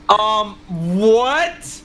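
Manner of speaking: drawn-out rising "what", acted for laughs; stunned disbelief. Storyteller reacting to news of a famous fan, stunned. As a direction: replay a baffled double take.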